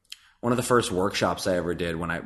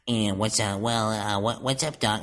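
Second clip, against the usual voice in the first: tentative cartoon rabbit impression, pitched up; Bugs Bunny; 3 semitones higher than usual. Nervous actor rehearsing the bunny alone in the bathroom, cartoon impression. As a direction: try out a high cartoon bunny catchphrase. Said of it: "I had to do the voice of Bugs".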